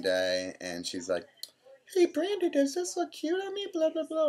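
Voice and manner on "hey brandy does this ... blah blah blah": much higher, girly mock mom voice; imitating mom. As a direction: squeal it like a fussy mom asking about her outfit. Mom voiced while shopping.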